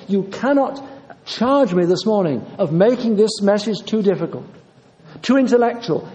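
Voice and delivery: ordinary talk, nothing marked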